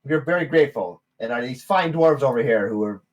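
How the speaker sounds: high, loud grateful gnome; gnome barkeep. Acted. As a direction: fawn over the paying dwarves. Gnome thanks guests.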